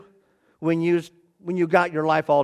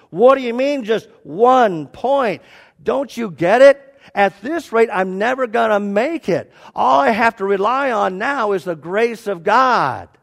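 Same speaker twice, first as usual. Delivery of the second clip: loud, high exasperated outburst; going off; 6 semitones higher, 6 dB louder than usual. Retold or imitated. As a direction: rant in acted despair at the scoring. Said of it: "this guy just kinda goes off".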